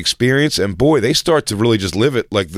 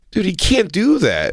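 imitated protest; 8 semitones higher than usual. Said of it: "is actually going like".

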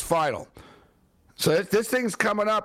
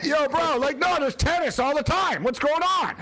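high-pitched, worked-up complaint; mock outrage; 10 semitones higher than usual. Host ranting to co-host about missing tennis, mock outrage. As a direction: protest in a high, exasperated voice.

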